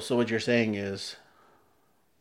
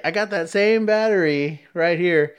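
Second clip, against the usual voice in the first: store salesman; 7 semitones higher, 5 dB louder than usual. Retold or imitated. Acts out salesman's pitch.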